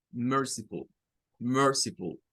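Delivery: «merciful» con última sílaba suave y tono descendente; final más suave. Shown as a demonstration. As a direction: deja caer «ful» suavemente en «merciful». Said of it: "es más suave". («merciful» con U reducida a schwa)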